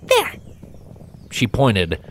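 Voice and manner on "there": very high griffin exclamation; pointing out the danger; squeaky-high sharp call; Gabby, spotting it. Acted.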